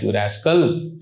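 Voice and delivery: a gentle grandfatherly rebuke; grandfatherly scolding. Retold or imitated. Grandfather admonishing a deviant grandchild, low fond scold. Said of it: "Like he's saying".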